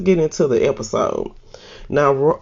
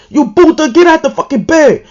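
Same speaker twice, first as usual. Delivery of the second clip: much higher, louder screeching mom yell; mama cursing; 14 semitones higher, 9 dB louder than usual. Retold or imitated. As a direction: shriek it like a furious mama catching her son in bed. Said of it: "mama comes in cursing".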